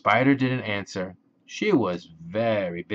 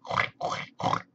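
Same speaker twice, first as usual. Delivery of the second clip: short voiceless pig snorts, softer; pig grunts; 4 dB quieter than usual. A narrator's reading. Pig at the busy spider's web, grunting. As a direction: snort like a pig, three times. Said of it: "grunted the pig".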